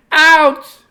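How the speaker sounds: high loud yelp; bird voice. Acted. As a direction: cry out as Bird. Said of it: "said Bird".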